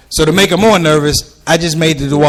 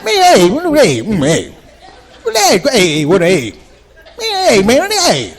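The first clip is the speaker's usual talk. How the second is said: put-on high, broken hey-hey old man voice; old dad mumbling; 4 semitones higher, slower than usual. Father failing to ask son to cut grass, broken old-man heys. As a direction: fumble for the words like an old father. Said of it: "but it came out like this".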